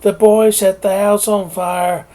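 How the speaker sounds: loud, slow, high call across the house; little sister hollers to the helper for help; hollering for help. A narrator's reading. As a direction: call out to tattle on the boy. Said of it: "She hollered".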